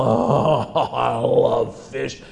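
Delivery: slow, relishing groan of pleasure; savoring delight. Acted. Starving swimmer smelling cooked fish, slow savoring delight. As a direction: draw it out as if inhaling the aroma.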